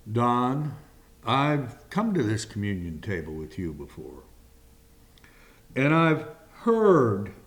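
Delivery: ordinary talk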